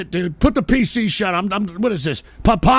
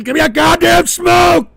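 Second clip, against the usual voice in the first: loud, higher frustrated demand; angry shout; 9 semitones higher, 8 dB louder than usual. Real, not acted. Host fed up with the show, shouting for a cigarette. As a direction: bark for a smoke.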